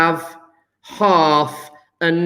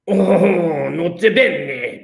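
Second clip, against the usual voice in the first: slow, stretched mock French accent; badly faked French accent, half laughing; much slower than usual. Shown as a demonstration. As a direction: have a hopeless go at a French accent. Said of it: "what's a French accent sound like?".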